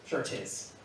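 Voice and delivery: "Sure 'tis" said low and almost unvoiced; a quiet, easy Irish "Sure 'tis"; soft Irish aside. Quoting an Irish reply softly to the audience. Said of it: "Irish people say tis".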